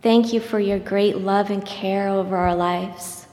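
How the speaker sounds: ordinary delivery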